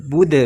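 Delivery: "Bud" with a bouncing voiced d at the end; dal dipantul. Shown as a demonstration. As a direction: let the d of "Bud" echo into a short release. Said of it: "ingat ya, dipantul". ("Bud" dengan qalqalah memantul)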